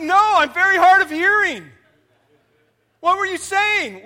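high, loud answer; hard of hearing frog. Acted. Deaf frog loudly answering the frogs up top.

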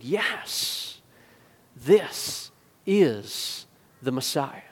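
slow, soft dawning conviction; quiet awe, long pauses between words; hushed realization. Acted. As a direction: let it sink in word by word. Acted hushed dawning conclusion.

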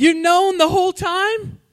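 high, slow disbelieving question; high-pitched stunned son; shocked son. Retold or imitated. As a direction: gasp it out in disbelief. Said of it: "He said".

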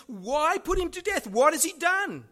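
high, loud protest; pleading shout. Retold or imitated. Jonathan challenges his raging father Saul, high loud protest. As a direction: cry out in his friend's defence.

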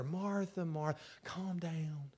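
soft, slow soothing; calming. Retold or imitated. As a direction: settle her down gently. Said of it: "Jesus answered and said to her".

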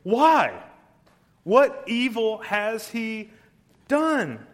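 high, loud, slow incredulous question; pleading disbelief. Acted. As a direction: protest the crowd's choice in disbelief. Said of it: "He says".

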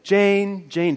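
calling her name out, high and loud. Retold or imitated. Father searching the house for his lost daughter, calling loudly. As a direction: shout the child's name across the house. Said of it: "Pretty soon I'm, like, screaming it".

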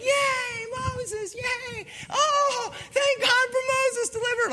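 very high, squealing hooray; mock cheering. Israelites welcoming Moses as deliverer, high mock cheering. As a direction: cheer like an overjoyed crowd, hammed-up.

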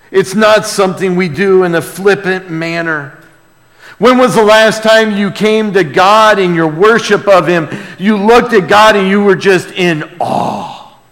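ordinary delivery

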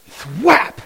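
sharp high loud "thwap"; rope whack sound. Retold or imitated. Imitating the rope hitting the hat.

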